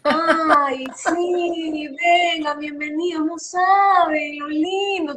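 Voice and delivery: exaggerated Guayaquil accent, sung and slowed; Guayaquil singsong accent. Shown as a demonstration. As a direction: stretch every vowel like a pampered Guayaquil girl welcoming guests. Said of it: "todo lo alargamos, como que medio lloramos con risa".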